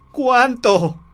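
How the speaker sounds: high, loud, aghast; horrified question. Acted. Father asks envoy about the money, horrified. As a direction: cry it out in horror. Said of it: "Miró con horror al visitante y le preguntó".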